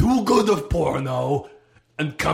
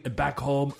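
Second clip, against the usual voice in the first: normal talking voice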